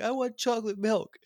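put-on high, loud pleading child; whiny kid voice. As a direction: beg like a kid stalling bedtime. Kid demanding chocolate milk.

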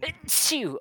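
loud sudden sneeze; sneezing. Real, not acted. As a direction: sneeze hard into the mic. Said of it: "That was so in there".

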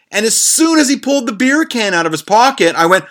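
normal talking voice

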